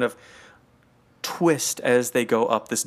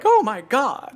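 mock gasp of wonder, pitched way up; astonished; 11 semitones higher, 4 dB louder, slower than usual. Tourist gasping at London in astonishment. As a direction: act amazed at a simple view. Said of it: "like, astonishingly".

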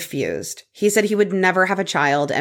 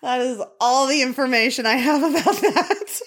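higher-pitched, laughing through the words; amused, laugh-filled admission; 6 semitones higher than usual. Real, not acted. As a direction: barely get it out for laughing. Laughing at the absurd chicken scam.

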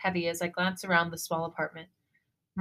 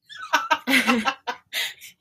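laughing; 6 semitones higher, 5 dB louder than usual. Real, not acted. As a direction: burst of laughter. Laughing at flubbed word.

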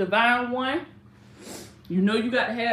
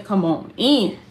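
louder, calling the viewers in; 9 dB louder than usual. Real, not acted. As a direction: call them in from across the room.

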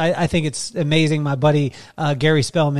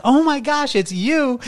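high, mock-starstruck squeal of recognition; gushing fan; 10 semitones higher, slower than usual. Husband imagines wife greeting him like a star, mock gushing. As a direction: gush like a pretend adoring fan.

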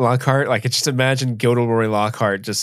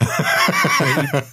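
laughing; much slower than usual. Real, not acted. Laughing mid-joke.

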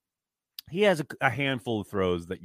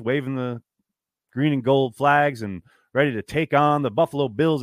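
ordinary delivery

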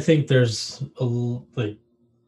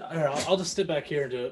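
normal talking voice

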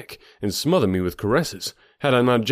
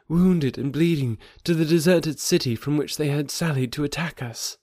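higher voice, mournful account; grieving young woman; 7 semitones higher than usual. A narrator's reading. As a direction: recount his fall with sorrow. Thuvia recounts the battle.

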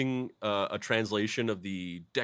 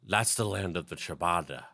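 put-on Italian accent; 3 semitones lower than usual. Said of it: "whenever you say ciabatta in that accent".

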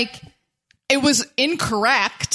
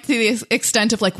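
ordinary delivery

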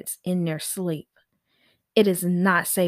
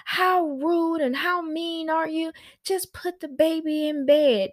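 higher, slow indignant mock voice of angry critics; mocking mad critics; 8 semitones higher than usual. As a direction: parody the outraged commenters, pitched up. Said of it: "And those people were so mad".

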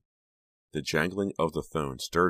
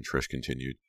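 ordinary delivery

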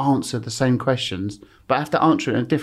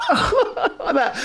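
breathless whispered giggle, pitched way up; imitating stifled laughter. Mimicking the cameraman holding in laughter, whispered giggle. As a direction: act out a cameraman trying not to crack up. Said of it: "the cameraman's going".

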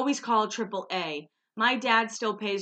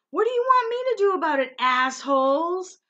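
mock angry yell; 9 semitones higher, 7 dB louder than usual. Kristen yelling at drivers beeping at her, mock angry shout. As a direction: yell it back at honking drivers. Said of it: "She goes".

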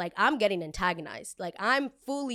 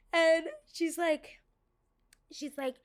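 drawn-out high lead-in; 8 semitones higher, slower than usual. Retold or imitated.

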